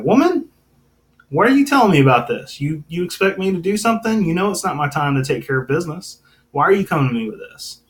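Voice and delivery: high, fast and sneering, a mock-nasty brush-off; sarcastic, nasty. Pastor reading Jesus brushing off his mother, sarcastic and nasty. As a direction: snap it back at mom as if she's a nuisance. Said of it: "you get this tone of, like, almost nastiness".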